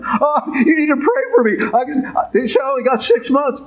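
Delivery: squeaky mock panic asking for prayers; mock panicked plea. Preacher mimics a frightened parishioner, high whiny plea. Imitating frightened believers after diagnosis.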